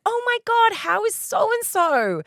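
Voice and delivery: bright gushing high voice; excited mock self. Speaker on the phone with her husband, high eager question. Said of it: "I was like".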